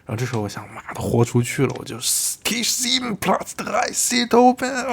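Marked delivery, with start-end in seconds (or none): 2.0-4.9 very high, drawn-out re-enacted yell; all-out shout. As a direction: let go and holler the announcement slowly. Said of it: "我就喊出来了".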